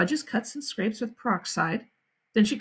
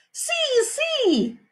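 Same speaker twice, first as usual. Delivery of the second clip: put-on high, eager toddler voice; excited little kid. A narrator's reading. Little brother up on the jungle gym, high eager voice. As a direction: pipe up like a toddler showing off.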